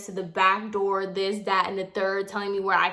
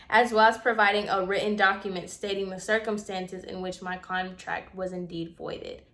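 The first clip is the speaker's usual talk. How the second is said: formal message; 5 dB quieter than usual. Retold or imitated. Tenant reading her written request to Monica, formal and subdued. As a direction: read it out evenly and formally, quieter.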